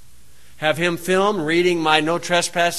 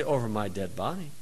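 low, very soft, steady; very calm; 9 semitones lower, 14 dB quieter than usual. Retold or imitated. Parent to official, very calm low threat. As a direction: say it quietly and dead calm. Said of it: "Very calm, say".

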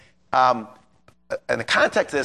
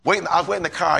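ordinary delivery

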